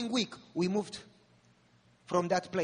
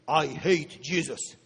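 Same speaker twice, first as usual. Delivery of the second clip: put-on lower, slow, rough bass growl of a possessed girl; deep smoker's demon voice; 5 semitones lower, 6 dB louder, slower than usual. Possessed girl tells the pastor she hates Jesus, in a deep smoker's voice. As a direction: drag it out like a chain-smoking demon. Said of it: "One voice deep, like a smoker".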